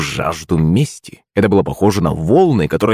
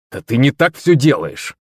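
irritated outcry; 5 semitones higher than usual. A narrator's reading. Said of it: "вскрикнул Саня".

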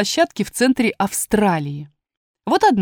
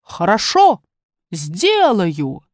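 storybook god calling out agreement; lightly acted cry of consent; shouted consent; much slower than usual. A narrator's reading. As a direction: call it out, drawing out the last word. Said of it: "он сел в воде и закричал".

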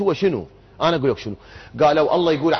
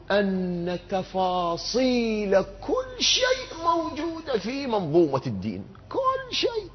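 slow, drawn-out high delivery, softly held; voicing an all-embracing claim, high and lingering; grand sweeping claim; 5 semitones higher, 7 dB quieter, much slower than usual. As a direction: stretch the words out high and slow. Voicing the maximalist expectation.

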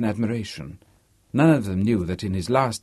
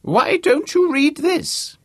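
put-on very high, loud, slow old-man voice; crabby elder giving an order; 6 dB louder, slower than usual. A narrator's reading. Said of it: "his voice loud, old, and very convincing".

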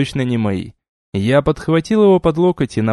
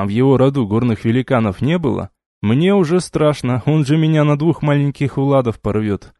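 ordinary delivery

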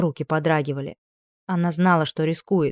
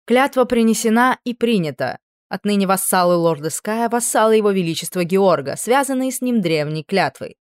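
solemn, higher ceremonial proclamation; 3 semitones higher, 4 dB louder than usual. A narrator's reading. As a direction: proclaim it like a court herald. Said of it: "торжественно произнёс лорд Пауэрли".

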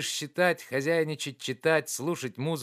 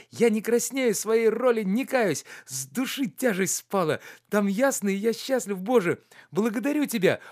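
higher and louder, bursting with joy; joyful; 10 semitones higher, 5 dB louder than usual. A narrator's reading. Oblomov thanking God for his friend's marriage, overjoyed. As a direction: let the relief spill out happily. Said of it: "а теперь весело".

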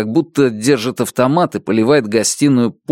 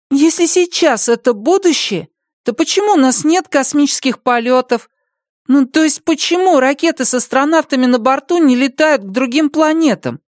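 put-on child voice; 14 semitones higher than usual. Boy asks.

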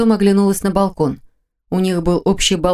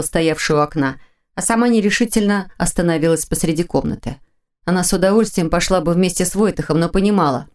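usual speaking voice

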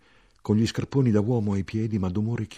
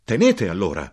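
louder, insistent; shout; 7 dB louder than usual. Acted. Narrator shouting to the soldier to take it. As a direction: thrust the words at him.

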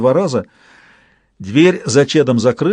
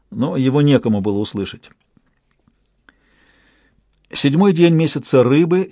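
ordinary delivery

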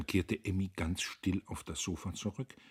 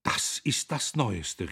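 slow, louder indignant exclamation; raised voice, pitched up; calling out; 4 semitones higher, 6 dB louder, slower than usual. A narrator's reading. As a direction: exclaim it in disbelief. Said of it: "riefen Emmy und Magda".

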